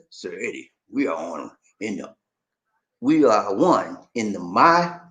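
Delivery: low, soft, slow impersonation; put-on deep voice. Host reading a listener's message in character, low slow voice. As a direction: read it deep and hushed, drawing out the ending.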